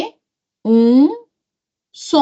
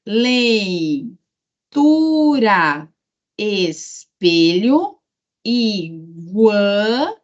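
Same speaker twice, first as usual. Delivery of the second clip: slow syllable reading; slower than usual. Shown as a demonstration. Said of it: "teacher reads word list".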